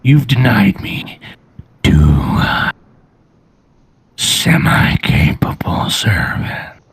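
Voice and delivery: low, rasping dragonborn menace; deep gravelly voice. Acted. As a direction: growl it slowly from deep in the throat. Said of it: "A deep, gravelly voice".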